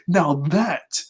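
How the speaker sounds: mock gloating villain; villain quote. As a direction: savour the word entertainment like a showman villain. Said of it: "He goes".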